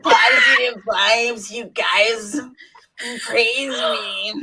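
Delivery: high, loud wailing character voice; hammed-up wail, close to cracking up; Ghost of High Heart. Host attempts requested character for friends, Ghost of High Heart voice. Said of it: "Do it in the ghost of high heart voice".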